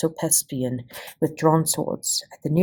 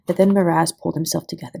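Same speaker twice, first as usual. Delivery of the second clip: ordinary delivery, nothing marked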